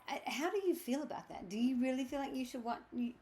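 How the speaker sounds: ordinary talk, nothing marked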